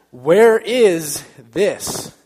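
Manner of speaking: loud, drawn-out mock demand; rude customer. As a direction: imitate a rude shopper, slow and loud.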